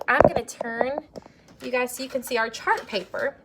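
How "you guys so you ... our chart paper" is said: normal talking voice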